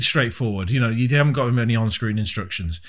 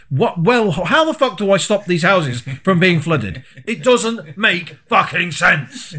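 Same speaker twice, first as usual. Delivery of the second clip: raised pitch, slowing to hammer out the last words; worked-up, higher-pitched exasperation; exasperated gamer rant; 6 semitones higher, 3 dB louder, slower than usual. Retold or imitated. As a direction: rant in baffled frustration at the game. Acting out exasperation with the game.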